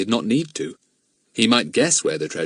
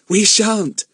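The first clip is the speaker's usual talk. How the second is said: put-on high, louder childish retort; insistent girl; 12 semitones higher, 5 dB louder than usual. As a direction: pipe up in cheerful contradiction. Lucy Ann contradicts Bill's warning.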